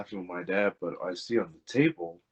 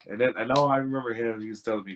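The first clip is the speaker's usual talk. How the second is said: normal talking voice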